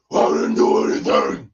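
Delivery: raspy, voiceless loud croak; hammed-up hoarse protest; venting outburst. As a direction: strain it out like a wronged victim. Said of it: "had to get it out my chest".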